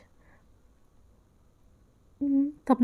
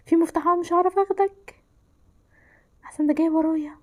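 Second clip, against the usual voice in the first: ordinary talk, nothing marked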